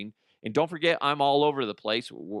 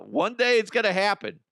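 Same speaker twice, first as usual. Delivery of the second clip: ordinary talk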